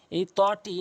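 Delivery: ordinary talk, nothing marked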